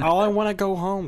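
put-on high, loud whining child; whiny kid. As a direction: whine like a bored kid. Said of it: "complained, like".

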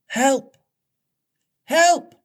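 high, thin call for help; feeble cry for help. A narrator's reading. Peter and Wendy stranded on the rock, feeble high cry. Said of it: "a feeble cry".

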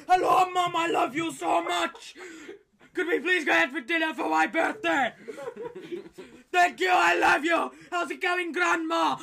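loud, high shouting in fake German tone; mock angry German. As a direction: shout sweet words as if furious. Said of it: "Whatever they say, they sound very angry".

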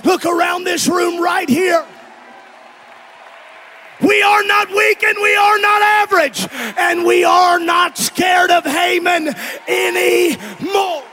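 preaching. Acted. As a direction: declare it over the congregation. Preaching at full force.

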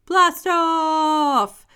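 high, loud, drawn-out launch cry; excited group cheer; big cheer. A narrator's reading. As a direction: let it rip like a rocket taking off. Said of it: "cheered all the little astronauts".

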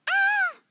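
sharp frightened cry; startled scream. Acted. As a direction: yelp in fright. Startled by ghosts.

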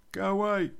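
high, loud mimicked dismissal; mock irritated shout. Roommate shouting from upstairs to be left alone, mock irritated shout. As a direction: imitate her yelling from behind a door.

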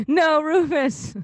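high, slow mock plea to the dog; begging the dog, hammed-up; mock pleading. Voicing the dog's owner.

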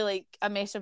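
ordinary talk

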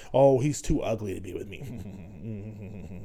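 low, slow, quiet mock of a scornful woman; snooty woman mimic. Mimicking a woman who rejects him, slow and quiet. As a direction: sneer it out as a dismissive woman, slow and soft. Imitating dismissive women.

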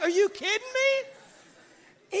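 high, slow, incredulous cry; disbelief. Retold or imitated. Preacher reacting to a tennis player quitting, high and incredulous. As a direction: stretch out the disbelief. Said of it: "I'm like".

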